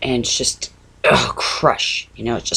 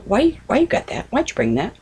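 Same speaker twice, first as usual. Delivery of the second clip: pitched up high, a nagging daughter's complaint; daughter's annoyed question; 10 semitones higher than usual. Retold or imitated. Mother imitating her daughter's complaint about the crochet, pitched up high. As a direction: mimic her irritated whine, high. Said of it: "she goes".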